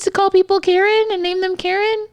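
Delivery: very high, put-on cooing voice; exaggerated cutesy high pitch; cutesy reminiscing. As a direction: gush in a high, cute mock-nostalgic squeal. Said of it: "it'll be, like, a cute".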